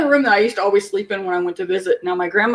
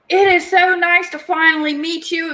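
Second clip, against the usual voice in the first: gushing aunt; 7 semitones higher than usual. Retold or imitated. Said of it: "my Aunt Vicky goes".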